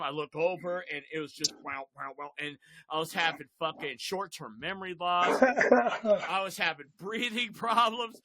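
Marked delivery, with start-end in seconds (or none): 5.4-8.2 slow, laughing through it. Real, not acted. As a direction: laugh while you say it. Laughing while telling.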